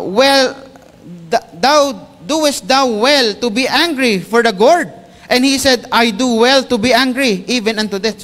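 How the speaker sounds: high and loud, slow on the verse; preaching at full force. Acted. Pastor voicing God questioning angry Jonah, loud preaching. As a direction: proclaim the rebuke like from the pulpit.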